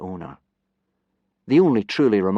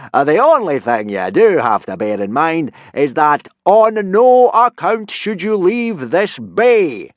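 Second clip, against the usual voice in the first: loud, higher blustering Viking; booming teacher; 9 semitones higher, 11 dB louder than usual. Acted. Viking teacher instructing boys in a booming voice. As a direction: lecture the lads at full volume. Gobber's voice held.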